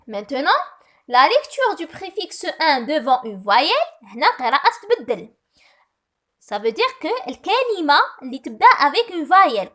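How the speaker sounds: ordinary talk, nothing marked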